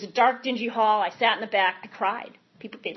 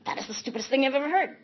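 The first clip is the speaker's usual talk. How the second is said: sarcastic inner voice. Retold or imitated. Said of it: "Sarcasm and nastiness".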